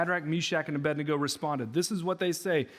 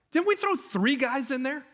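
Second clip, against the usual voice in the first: high, baffled double-take question; surprised; 8 semitones higher than usual. Retold or imitated. King staring into the furnace at four men, startled and high. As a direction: pitch it way up in disbelief.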